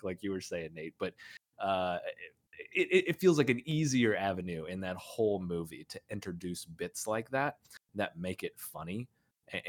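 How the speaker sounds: ordinary talk, nothing marked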